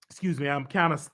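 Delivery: normal talking voice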